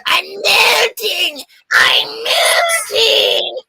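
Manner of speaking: very high, very slow mock wail; melting witch. Recalling the death scene, very high slow mock wail.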